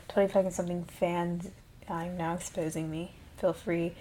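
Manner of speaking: ordinary talk, nothing marked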